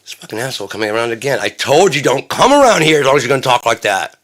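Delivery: irate outburst, rising in volume; acted angry warning. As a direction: run off an unwelcome visitor. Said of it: "they're probably like".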